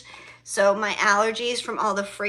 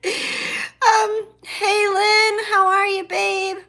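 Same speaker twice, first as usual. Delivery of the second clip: high, loud, laughing hello; laughing greeting; 9 semitones higher, 8 dB louder, slower than usual. Real, not acted. Greeting her friend Lynn while laughing, high and loud.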